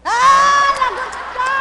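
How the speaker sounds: loud, high playful yell; mock-scold the listener loudly; teasing shout. Real, not acted. (pabirong sigaw na "Lagot ka!")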